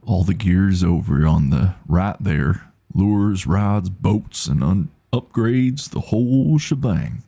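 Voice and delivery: slow, drawling shopkeeper; Rusty. Acted. Streamer voicing the tackle shop owner, slow drawl. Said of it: "I don't know how Rusty should talk".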